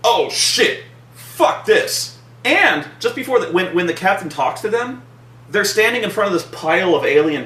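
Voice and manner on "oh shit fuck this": mock alarm.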